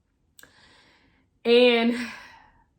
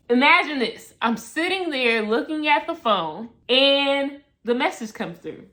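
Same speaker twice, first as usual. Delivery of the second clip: ordinary talk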